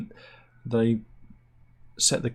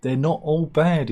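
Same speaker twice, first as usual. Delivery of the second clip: 1 quoting doctor; 5 semitones higher, 5 dB louder than usual. Retold or imitated. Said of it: "he said".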